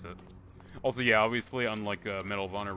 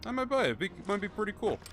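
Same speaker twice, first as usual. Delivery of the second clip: much higher, eager repeat of I might buy it; excited; 10 semitones higher than usual. Real, not acted. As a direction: sell it as a gleeful temptation. Repeats purchase line, pitched up.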